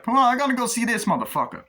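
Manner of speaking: high, loud, fast hammed-up tough guy; mock gangster yell. Acted gangster dialogue from movie.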